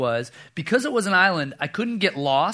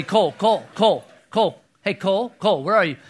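loud, urgent calls; dad hurrying through a cave calling for his boy; 3 semitones higher, 6 dB louder than usual. Retold or imitated.

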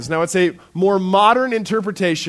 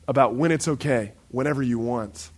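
usual speaking voice

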